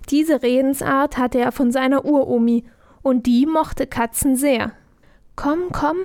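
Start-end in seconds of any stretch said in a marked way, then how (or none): 5.4-6.0 coaxing. Acted. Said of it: "lockte Franz".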